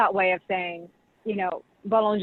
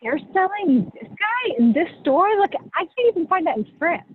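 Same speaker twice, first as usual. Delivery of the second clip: astonished quote; 10 semitones higher than usual. Retold or imitated. Champagne visitors marvel at a small grower, incredulous. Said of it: "they're like".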